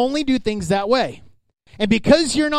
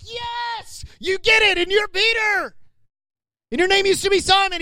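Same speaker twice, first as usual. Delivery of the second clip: high, bright-eyed praise, much higher than his own voice; excited Jesus; 11 semitones higher than usual. Retold or imitated. Jesus praising Peter's answer, high and excited. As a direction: cheer him on like a proud teacher.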